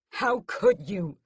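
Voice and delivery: slow, loud angry accusation; yelled. A narrator's reading. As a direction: yell it at his mother. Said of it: "I yell at her".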